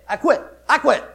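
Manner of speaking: loud, high, firm repeat; emphatic declaration. Retold or imitated. Preacher recalling quitting his job, loud and firm.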